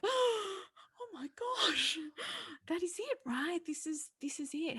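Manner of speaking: high, ecstatic, almost tearful exclaim; overjoyed realisation. Retold or imitated. Mum realising her daughter learned empathy, high and elated. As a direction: burst out with moved delight. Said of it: "And I was like".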